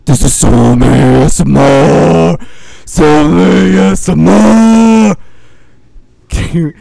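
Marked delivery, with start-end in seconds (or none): 0.1-5.2 slow, drawn-out yelling into the mic; yelled ASMR. Pretend. Host yelling a mock ASMR intro, slow and loud. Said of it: "a new category of A S M R where it's just yelling".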